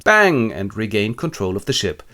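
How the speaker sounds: normal talking voice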